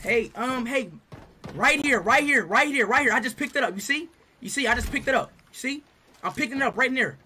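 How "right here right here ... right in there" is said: normal talking voice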